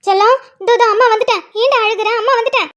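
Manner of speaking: put-on high-pitched baby-soothing voice; comforting mother. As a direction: coo reassurance to a crying child, pitched well up. Mother comforting crying child. (குழந்தையைக் கொஞ்சிச் சமாதானம் செய்யும் உயர்ந்த குரல்)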